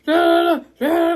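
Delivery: mock yell of an irate neighbor; mock angry yelling. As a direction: ham up a furious neighbor barking. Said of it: "like, really mad and yelling".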